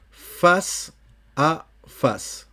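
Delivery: face dit /fas/, à la française; français face à face. Shown as a demonstration. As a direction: face à face, pas face to face. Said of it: "C'est pas face to face. Non, ça, c'est face à face". (prononcé à la française)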